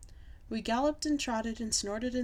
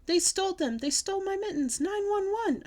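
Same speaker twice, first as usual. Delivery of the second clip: very high, loud wail of alarm; frantic shouting; 11 semitones higher, 5 dB louder than usual. A narrator's reading. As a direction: shout the crime out in a panic. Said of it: "I shouted some more".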